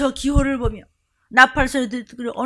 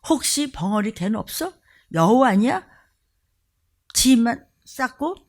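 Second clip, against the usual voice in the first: ordinary talk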